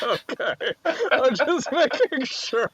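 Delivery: high giggly teasing; laughing, high. Real, not acted. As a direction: laugh through the words. Teasing about the opponent's deck.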